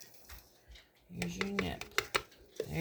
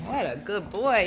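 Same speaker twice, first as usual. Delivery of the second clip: usual speaking voice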